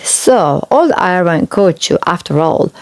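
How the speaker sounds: usual speaking voice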